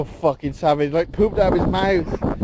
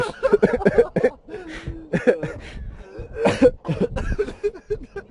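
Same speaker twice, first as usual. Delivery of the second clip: high laughing fit; 12 semitones higher than usual. Real, not acted.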